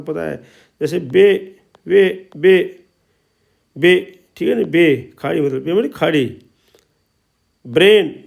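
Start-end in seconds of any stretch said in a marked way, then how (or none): 1.0-3.9 "बे", "वे" with the ए held long; teacher's word drill, lengthening the vowel in "बे". Shown as a demonstration. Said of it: "ए का उच्चारण लंबा कर दिया जाता है". 7.6-8.1 louder "ब्रेन" with a long ए; long ए vowel. Shown as a demonstration. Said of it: "ए का उच्चारण लंबा कर दिया जाता है".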